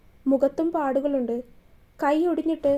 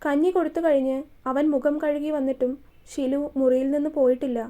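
ordinary delivery

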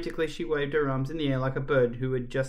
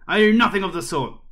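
loud, higher outraged retort; indignant denial; 6 semitones higher, 8 dB louder, faster than usual. Acted. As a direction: reject it with acted outrage. Caretaker indignantly rejects the praise.

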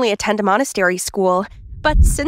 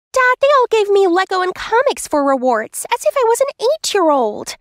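put-on much higher little-girl voice; complaining child; 9 semitones higher than usual. Gifted girl complaining to dad about her rewards, indignant. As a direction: protest like an affronted child.

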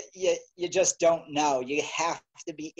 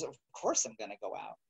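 matter of fact; 5 semitones lower, 9 dB quieter than usual. Retold or imitated. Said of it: "And he goes".

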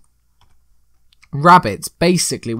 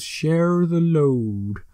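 put-on slow-motion film-quote accent, higher and drawn out; Sam's film accent; 5 semitones higher, much slower than usual. Gamer mimicking the slow-mo film scene, drawn-out imitated accent. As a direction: stretch it out in an imitated hobbit accent. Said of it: "in his accent".

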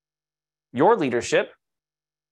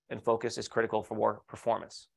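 ordinary talk

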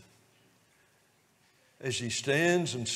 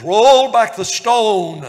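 much louder, slow commanding call; authoritative command; 8 semitones higher, 18 dB louder, slower than usual. Retold or imitated. Jesus ordering the stone rolled away, loud and commanding. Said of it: "with that same authoritative voice".

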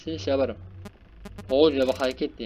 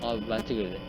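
usual speaking voice

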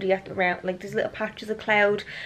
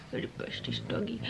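cooing whisper; 14 dB quieter than usual. Real, not acted. Owner doting on the pug, cooing whisper. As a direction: whisper it lovingly.

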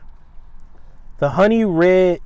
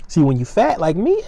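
normal talking voice

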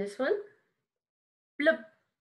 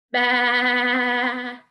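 loud long baa; loud sheep baa for kids; sheep bleat; 7 dB louder than usual. Shown as a demonstration. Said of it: "And this one?".

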